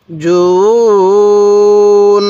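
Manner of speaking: "জুন" with the nasal n held very long; nasal ghunnah hold. Shown as a demonstration. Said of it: "মিমে নুনের তাজদিদ হলে গুন্নাহ করতে হবে".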